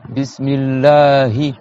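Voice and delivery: Bismillahi with a long drawn-out a; prolonged a. Shown as a demonstration. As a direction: stretch the a in Bismillahi slowly. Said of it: "tu vas prolonger le A".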